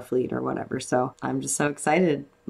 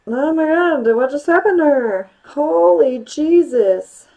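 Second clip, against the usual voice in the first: much higher and louder, aghast; horrified shock; 13 semitones higher, 10 dB louder than usual. Real, not acted. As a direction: recoil at something gruesome. Horrified reaction to a scene.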